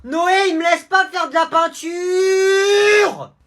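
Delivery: tattling shout. Acted. Little brother whining to mum.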